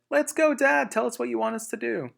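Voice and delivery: high, bright little-fox cheer; rally Dad with eager confidence; small foxes cheering their father on; eager child fox. Acted.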